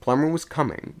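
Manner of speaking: plain reading voice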